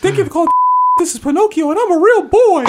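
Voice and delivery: put-on very high, childlike puppet voice; high Pinocchio voice. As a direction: squeak it like a wooden boy. Playing Pinocchio on phone.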